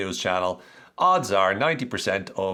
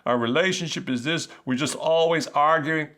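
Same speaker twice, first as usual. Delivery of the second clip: higher, hammed-up dramatic wail; mock panicked partner; 8 semitones higher than usual. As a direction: overreact like one fight ends everything. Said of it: "all of a sudden it's like".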